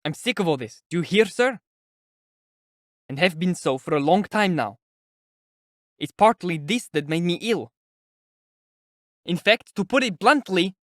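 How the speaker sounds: exasperated, near-shouted protest; almost shouting. A narrator's reading. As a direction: let irritation push the voice up towards a shout. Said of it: "he almost shouted".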